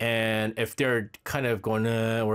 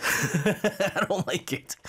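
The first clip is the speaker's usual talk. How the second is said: drawn-out and higher, laughing through it; 7 semitones higher, much slower than usual. Real, not acted. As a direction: repeat it slowly, laughing at yourself. Says it, then repeats laughing.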